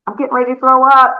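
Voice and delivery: hammed-up about to be sick; mock sick friend. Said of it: "with their hand over their mouth saying".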